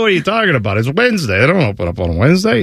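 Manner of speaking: mock Jersey shopkeeper, incredulous; hammed-up New Jersey rebuff; New Jersey accent. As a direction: brush off a customer in a put-on New Jersey accent. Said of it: "my horrible New Jersey accent".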